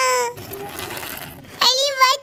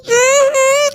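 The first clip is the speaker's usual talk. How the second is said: straining grunts; 6 dB louder than usual. Pretend. Child straining, ants in pants.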